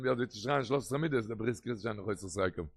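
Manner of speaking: usual speaking voice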